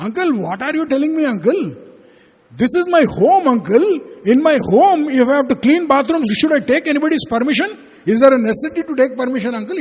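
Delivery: high, insistent questioning; retold protest. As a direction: argue back like an indignant boy. Boy argues back at uncle.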